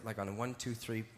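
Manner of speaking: ordinary delivery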